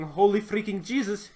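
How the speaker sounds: mock Russian exclamation; a light Russian put-on voice. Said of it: "using that voice".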